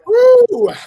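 loud high whoop; triumphant whoop. Real, not acted. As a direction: whoop after the big chug. After chugging beer.

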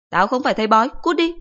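high, loud threatening shout; angry mother. Acted. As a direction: mom losing her temper. Mother shoos son.

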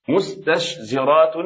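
مستشزرات slow and deliberate, each clashing consonant sounded; heavy pronunciation. Shown as a demonstration. Teacher repeating the hard example, مستشزرات slow and deliberate. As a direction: work slowly through مستشزرات. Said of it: "هذه الكلمة ثقيلة في نطقها".

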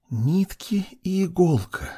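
high, soft, eerie whisper; creature whisper. A narrator's reading. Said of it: "шепчет существо".

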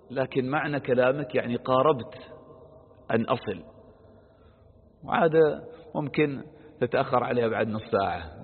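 ordinary talk, nothing marked

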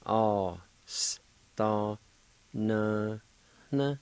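"Aw, s, ta, na, na" syllable by syllable, a breath of H before the last "na"; faint H before N. Shown as a demonstration. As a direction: separate each sound, lightly aspirating into the final "na" of "Aw, s, ta, na, na". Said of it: "There's a faint H sound".

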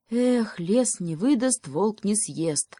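plain reading voice

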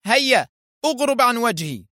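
high, irate send-off; shouted dismissal. A narrator's reading. Foster throws Arthur out, crying out angrily. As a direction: dismiss him with a high-pitched cry. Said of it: "صاح به فوستر".

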